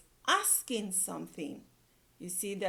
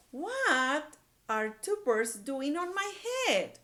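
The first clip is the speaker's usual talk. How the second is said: upset question; 6 semitones higher, 4 dB louder than usual. Acted. Gerald upset, voiced by teacher.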